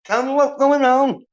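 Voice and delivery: high, whiny mock voice for a clueless viewer; mocking soy viewer. Streamer mocking a needy viewer, high whiny mock voice. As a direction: sneer it up high like a needy fan. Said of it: "where I'm like".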